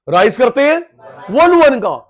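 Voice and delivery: slow, loud and high, every word hammered; emphatic rise. Shown as a demonstration. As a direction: drive the point home slowly and loudly.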